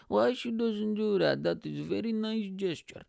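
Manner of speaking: put-on high-pitched, accented dad voice; put-on high voice for a puzzled dad; dad impression. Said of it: "And my dad goes".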